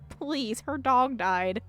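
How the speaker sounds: ordinary talk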